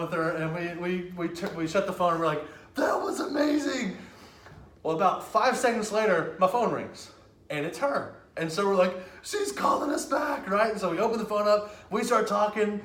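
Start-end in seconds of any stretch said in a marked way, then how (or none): 2.7-4.0 put-on high-pitched thrilled teen quote. Prankster to friends after hanging up, thrilled. Teens celebrating prank call in story. 9.2-10.5 high-pitched excited outburst. Retold or imitated. Prankster telling friends the lady is ringing back, excited.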